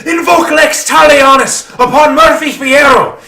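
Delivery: put-on high, loud, Spanish-accented proclamation of judgment; furious prince. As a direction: condemn him before the court, swelling to full force. Prince pronounces blood hunt on Murphy.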